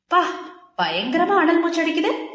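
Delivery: high, exaggerated mock amazement; teasing. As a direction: poke fun, rising in pitch. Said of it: "அவளின் கேலியில்".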